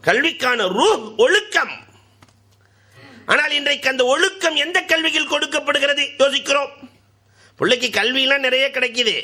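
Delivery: high, ringing sermon voice; fiery preaching. Acted. Preacher exhorting the beloved listeners, high and ringing. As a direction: preach it out to the crowd.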